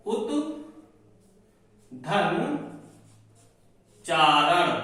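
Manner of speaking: उत धन चारण said in three slow separated chunks with pauses; split into parts. Shown as a demonstration. Teacher spelling out उत धन चारण piece by piece. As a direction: break उच्चारण into उत, धन, चारण. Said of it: "यानी आधा चा आया है".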